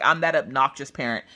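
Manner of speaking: usual speaking voice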